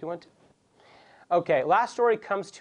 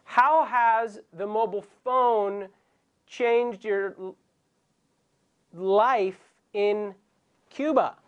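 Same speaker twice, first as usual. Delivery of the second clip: much slower, each word spelled out; slow repeat for the caller; 4 semitones higher, much slower than usual. Shown as a demonstration. Host repeating the question slowly to a confused caller, slow. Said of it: "¿Qué?".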